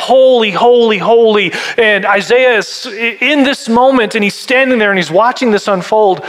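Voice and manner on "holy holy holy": loud, raised cry of worship. Acted. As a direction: call it out like circling seraphim. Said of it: "they're crying out".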